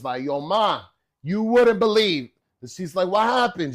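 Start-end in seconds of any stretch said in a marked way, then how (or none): 0.2-2.3 high, loud, stretched-out excitement; acting out breathless hype; super hyped. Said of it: "I was super hyped". 3.1-3.7 high, loud eager question; pitched-up curiosity; excited mother. Retold or imitated. Mother responds to excited son.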